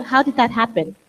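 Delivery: ordinary talk, nothing marked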